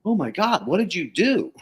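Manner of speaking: high, excited exclamation of disbelief; amazed client. Retold or imitated. Client calling back after a few months, amazed and excited. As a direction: gush like a stunned happy client.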